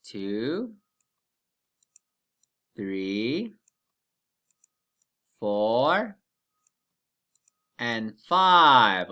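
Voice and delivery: teacher counting aloud for class; slow counting. Shown as a demonstration. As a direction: count slowly, one by one. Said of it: "Count with me".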